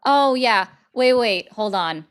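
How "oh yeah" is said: ordinary delivery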